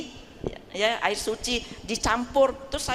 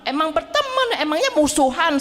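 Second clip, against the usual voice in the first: louder, higher comic comeback; mock retort; 4 semitones higher, 4 dB louder than usual. Patient teasing the doctor about religions, mock-indignant retort. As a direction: throw it back as a teasing challenge.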